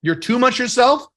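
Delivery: normal talking voice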